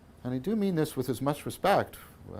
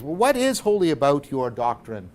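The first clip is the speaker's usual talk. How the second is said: upset prince; 3 semitones higher, 7 dB louder than usual. Retold or imitated. Prince pressing Bodhidharma for an answer, upset. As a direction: press the question with acted frustration. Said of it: "the prince gets upset".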